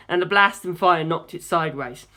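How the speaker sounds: plain reading voice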